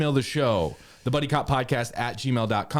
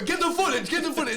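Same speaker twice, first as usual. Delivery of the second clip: frantic cameraman; 13 semitones higher, faster than usual. Retold or imitated. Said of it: "You're just holding a camera".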